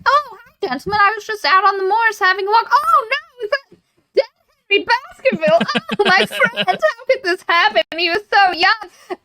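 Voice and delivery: high-pitched put-on innocent voice; theatrical, high mock dismay; Stapleton. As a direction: ham up the fake shock and grief. Stapleton's fake innocence and grief, acted.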